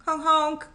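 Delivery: high honking horn imitation; car horn honk.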